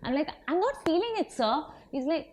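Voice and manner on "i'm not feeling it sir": mock protest. Retelling her protest to Ajit on set.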